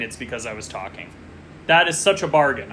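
ordinary talk

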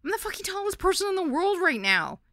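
high, loud squeal of delight; giddy high-pitched delight; delighted squeak. A narrator's reading. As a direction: squeak it out, thrilled to be tall. Giddy in new tall shoes.

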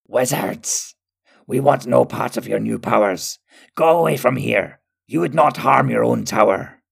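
forceful, vile villain calling out; vile creature voice. A narrator's reading. Skurg at the tower window warns off the wizards, vile shout. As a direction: call out defiantly in a vile voice. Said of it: "a vile voice sounded from a window".

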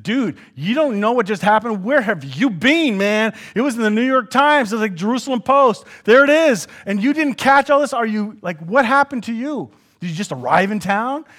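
loud, high mock disbelief; incredulous dude. Two travelers mocking Jesus, loud and incredulous. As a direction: scoff at the clueless stranger. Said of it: "but I said".

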